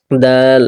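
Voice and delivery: "দাল" with the aa stretched three beats; "দাল" drawn out; long held vowel. Shown as a demonstration. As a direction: hold the aa of "দাল" long and level. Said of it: "তিন আলিফ লম্বা".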